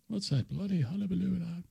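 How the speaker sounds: high, soft put-on Irish brogue; playful hammed-up Irish voice; mock Irish accent. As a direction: toss off a fake Irish line.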